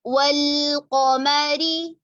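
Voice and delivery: वल्कमरी with heavy qaf and thin meem, slow; heavy ق, light م in वल्कमरी. Shown as a demonstration. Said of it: "मीम को बिल्कुल बारीक करेंगे". (क़ाफ़ मोटा, मीम बारीक)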